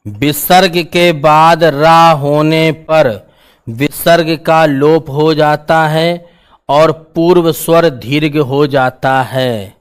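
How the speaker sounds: slow, deliberate rule dictation. Real, not acted. Teacher dictating a visarga sandhi rule, slow dictation. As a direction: dictate it word by drawn-out word.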